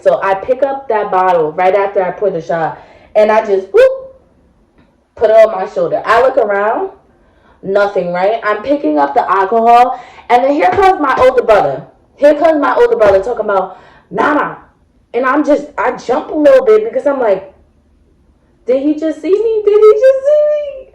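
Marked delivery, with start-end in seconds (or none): 3.7-4.2 sudden high-pitched whoop as the bottle goes in; a quick squeaky whoop of sneaky success; a little high yelp while stashing the bottle. Retold or imitated. Stashing the bottle in her bag. 18.7-20.9 jumpy panic, voice shooting up high; panicked inner thought. Retold or imitated.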